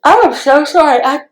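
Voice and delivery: talking through a yawn; words stretched by a yawn, laughing. Real, not acted. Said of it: "I just started yawning".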